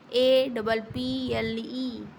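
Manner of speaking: each letter said very slowly and clearly, ए, डबल, पी, एल, ई; slow spelling out. Shown as a demonstration. Teacher spelling apple for young kids, very slowly. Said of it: "सबसे पहले बच्चे को सिखाया ही जाता है".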